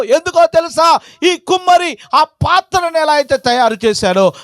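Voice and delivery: high, insistent pulpit questioning, building; impassioned preaching. Acted. Preacher challenging the weeping prophet Jeremiah, building to full force.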